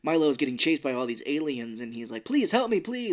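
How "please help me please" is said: high, desperate begging; high pleading. Retold or imitated. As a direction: beg for help in panic. Chased, begging for help.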